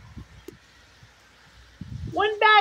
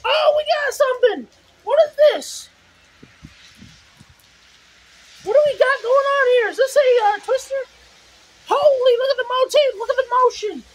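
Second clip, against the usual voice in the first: excited, higher and louder over wind; breathless shouting over wind; 5 semitones higher, 4 dB louder than usual. Real, not acted. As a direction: shout in awe at the storm. Spotting a possible twister.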